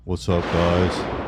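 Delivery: slow flat YouTuber greeting; monotone voice. Retold or imitated. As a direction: drag out the intro flatly. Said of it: "the whole monotone voice thing".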